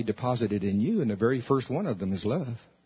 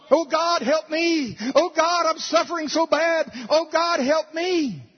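high, pleading mock prayer; whiny prayer; 13 semitones higher, 4 dB louder, slower than usual. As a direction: mimic a self-pitying believer begging God. Mocking a whiny immature prayer.

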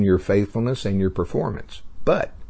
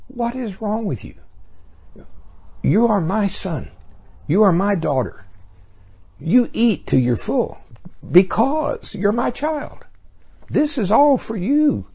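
loving grandfather; 11 semitones higher than usual. Acted. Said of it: "He'd say".

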